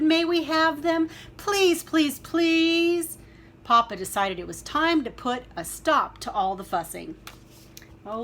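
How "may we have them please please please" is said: high, loud repeated pleading; begging shout. Acted. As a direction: keep begging loudly like children. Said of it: "they shouted".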